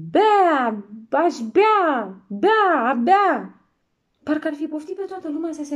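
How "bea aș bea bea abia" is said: put-on high, loud bawling baby cry; newborn bawling. Newborn giant bawling for drink, high and loud. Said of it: "zbieră cât îl ținură băierile".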